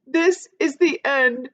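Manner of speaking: put-on high, slow, loud old voice; old grasshopper fearing the end; old grasshopper's doom. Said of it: "said the old green grasshopper".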